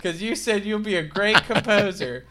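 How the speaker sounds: high, loud mock warning; threatening father. Father warning Hans, loud mock menace. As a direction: warn him, hammed up. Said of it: "He's like".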